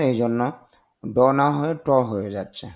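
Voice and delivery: ordinary talk, nothing marked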